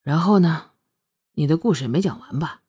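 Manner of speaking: low and subdued male figure; deep, low voice. A narrator's reading. Lingfeng asks Shuangyue about the unfinished story, deep and low. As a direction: drop the voice low and ask evenly. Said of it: "凌风声音低沉地说".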